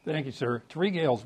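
ordinary talk

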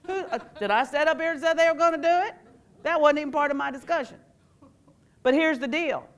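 ordinary talk

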